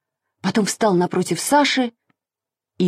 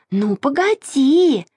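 slow, higher-pitched defiant threat; bold; 7 semitones higher, slower than usual. A narrator's reading. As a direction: stretch out a plucky little threat. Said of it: "смело сказала Маша". (смело, с вызовом, нараспев)

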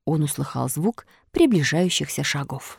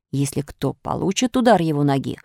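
plain reading voice

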